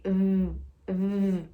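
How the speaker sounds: short buzzing v sounds. Shown as a demonstration. Teacher making students feel the vibration. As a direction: let the v vibrate. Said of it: "Repare que o V".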